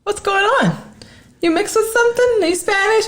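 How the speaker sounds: very high-pitched mock questioner; put-on high voice, prying; nosy questioner. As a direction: imitate a nosy stranger in a squeaky-high voice. Acting out people prying about background.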